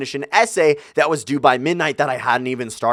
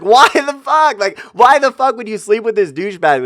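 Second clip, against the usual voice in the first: much higher and louder, outraged at her; 9 semitones higher, 5 dB louder than usual. Retold or imitated. Demanding why his girlfriend slept with the douchebag, outraged. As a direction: demand an answer, disgusted.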